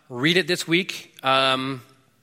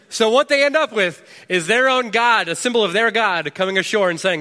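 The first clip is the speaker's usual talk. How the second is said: climbing high and loud with excitement; excited build; 6 semitones higher, 4 dB louder than usual. Real, not acted. Preacher thrilled at the irony, high and loud. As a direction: sell the twist with rising energy.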